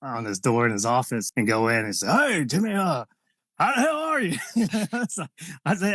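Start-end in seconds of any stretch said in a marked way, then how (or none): 2.1-4.4 high, animated boss impression; Vince McMahon. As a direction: greet him like a big, hearty boss. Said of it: "I wanna go thank Vince McMahon".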